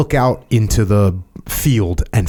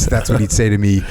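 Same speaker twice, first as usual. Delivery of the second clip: ordinary delivery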